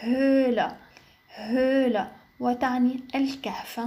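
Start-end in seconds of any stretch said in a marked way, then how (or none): 0.0-2.1 German "هولة" with long ö and silent inner h; light h. Shown as a demonstration. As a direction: say "هولة" as Höhle, holding the ö. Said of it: "وتنطق الها خفيفة". ("هولة" بحرف ö طويل وهاء خفيفة)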